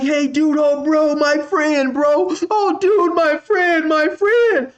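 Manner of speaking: high, strained pleading voice of a scared stranger; mock the begging driver way up high. Panicked driver begging after fight.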